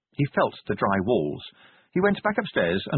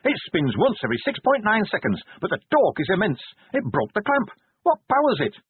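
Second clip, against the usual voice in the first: eager inventor; 10 semitones higher than usual. Acted. Pony voiced, eager inventor.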